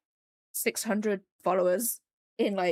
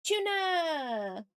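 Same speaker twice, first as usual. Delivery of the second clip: excited greeting; 9 semitones higher than usual. Real, not acted. Greeting a viewer by name.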